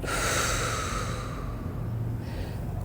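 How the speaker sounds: slow audible exhale; long breathy sigh. Acted. Deep exhale in meditation.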